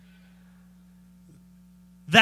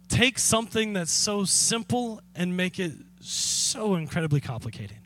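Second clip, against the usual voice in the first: normal talking voice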